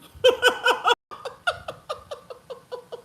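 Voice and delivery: long, high, loud burst of laughter. Real, not acted. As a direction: laugh hard at the absurdity. Laughing about assigned baby.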